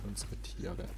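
usual speaking voice

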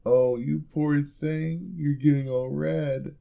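high, slow, cooing tease; syrupy fake sympathy; mock pity. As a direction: coo over them like a helpless pet. Teasing the blushing listener.

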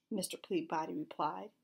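usual speaking voice

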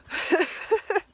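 bright high laugh; giggling at the horse; high giggle. Real, not acted.

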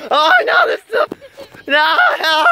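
very high laughing shrieks; playful panic. Pretend. Kids chasing him.

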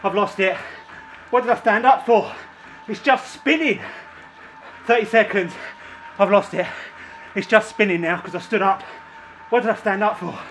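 breathless, frustrated panting; winded, groaning at the pedals; out of breath. Real, not acted. Losing cadence, exhausted.

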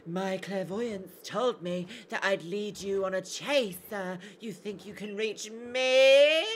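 slow, drawn-out psychic voice; psychic child Sabby. Acted. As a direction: stretch it like a clairvoyant, rising at the end. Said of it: "She's a psychic. She'd be like".

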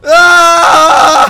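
a sudden high loud yelp; startled yell. Real, not acted. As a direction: yelp as the raft shoots off. Flying again on the raft. (ani tiz bağırış)

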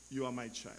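very soft, low and tender; a loving father's quiet reassurance; warm fatherly voice. Acted. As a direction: drop to a gentle, intimate murmur. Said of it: "that warmness of the Father's voice".

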